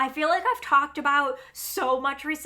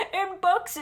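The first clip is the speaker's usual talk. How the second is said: laughing; 8 semitones higher, 5 dB louder than usual. Real, not acted. Laughs about feeling understood.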